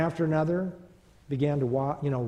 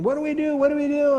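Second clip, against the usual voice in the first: much higher and louder frantic screaming; screaming in panic; 12 semitones higher, 7 dB louder, faster than usual. Retold or imitated. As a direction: scream it like terrified boys. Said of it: "the boys were screaming".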